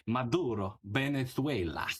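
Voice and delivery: mock the exaggerated Spanish "Maduro, Venezuela"; Spanish pronunciation. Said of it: "did pronounce Maduro and Venezuela properly".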